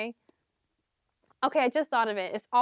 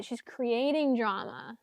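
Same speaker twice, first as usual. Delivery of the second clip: ordinary delivery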